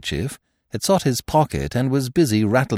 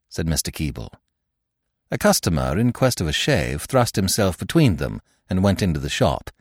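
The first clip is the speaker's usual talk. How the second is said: plain reading voice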